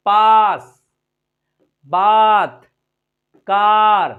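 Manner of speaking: "पास, बात, कार" very loud, with stretched आ; wide-mouthed आ. Shown as a demonstration. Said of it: "मुंह फाड़ सकते हैं".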